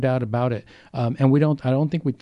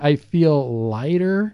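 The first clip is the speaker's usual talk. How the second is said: quoting a relieved person slowly; relieved quote; much slower than usual. Retold or imitated. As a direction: draw it out with relief. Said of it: "And they'll say".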